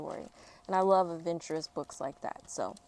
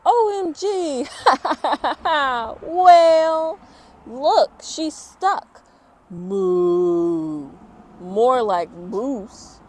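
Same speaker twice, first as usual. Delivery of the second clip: loud, slow, laughing taunts; mocking kids; 6 semitones higher, 13 dB louder, much slower than usual. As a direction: jeer at her like cruel classmates. Children mock the stuck girl.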